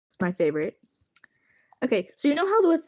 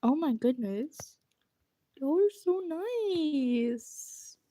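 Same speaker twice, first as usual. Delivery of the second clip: squeaky gushing; 5 semitones higher, 5 dB quieter, slower than usual. Real, not acted. Overwhelmed by kind chat.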